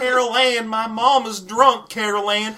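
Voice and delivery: high, drawn-out Southern wail; hammed-up Southern daughter calling out; southern accent. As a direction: call through the house in a mock Southern voice. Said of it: "she has this soft southern accent".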